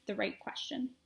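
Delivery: ordinary delivery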